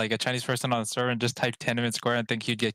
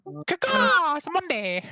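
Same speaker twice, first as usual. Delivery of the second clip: crow call; 3 dB louder, much slower than usual. Pretend. Mock bird call on Monday.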